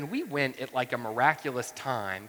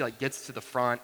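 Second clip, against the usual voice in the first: ordinary delivery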